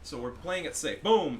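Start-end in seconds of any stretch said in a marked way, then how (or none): 1.0-1.4 sudden loud, high burst; triumphant gamer call; excited exclamation. Real, not acted. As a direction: punch it out as the shot lands. Calls a hit in game.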